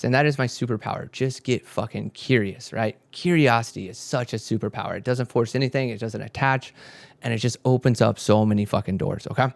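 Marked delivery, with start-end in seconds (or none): none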